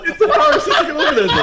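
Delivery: high, loud shriek of laughter; laughing hard. Real, not acted. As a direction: blurt it out while cracking up. Helpless laughter at the mustache.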